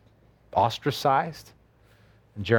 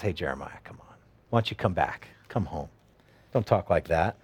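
low, soft voice of God calling someone home; gentle coaxing; 7 semitones lower, 5 dB quieter than usual. Acted. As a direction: coax the prophet back, low and hushed. God coaxing Jeremiah back.

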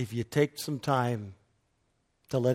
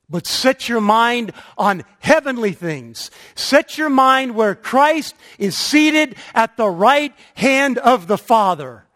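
high, loud scripture proclamation; preaching; 9 semitones higher, 12 dB louder than usual. Real, not acted. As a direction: proclaim the verse with full force. Quoting scripture at full force.

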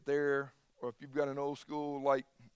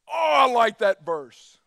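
loud, slow, high mock gush; hammed-up delight at a comfy verse; gushing believer; 5 semitones higher, 14 dB louder, slower than usual.

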